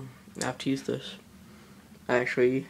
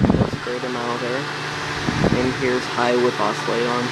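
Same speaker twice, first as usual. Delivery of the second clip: raised voice over fan noise; 12 dB louder than usual. Real, not acted. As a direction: project over the fan's roar. Talking over a running fan.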